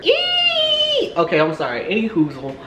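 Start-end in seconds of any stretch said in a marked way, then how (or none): 0.0-1.1 a very high, piercing squeal of embarrassment; embarrassed squeal. Real, not acted. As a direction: shriek it out, mortified. Embarrassed at her own story.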